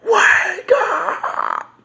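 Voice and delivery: high, drawn-out mock scream; imitated movie scream. Vlogger quoting Lawrence Fishburne's movie line, imitated scream. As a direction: imitate an actor screaming, stretching each word. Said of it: "and he's screaming out".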